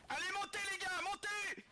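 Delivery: rallying shout. Acted. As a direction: hurry the troops up. Commandant presse les soldats. (ordre crié)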